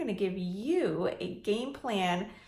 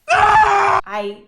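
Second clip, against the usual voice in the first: much louder, higher panicked shouting; frantic shout; 10 semitones higher, 15 dB louder than usual. Retold or imitated. Landlord flipping out over tenant repair calls, shouting. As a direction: yell it like you're losing it.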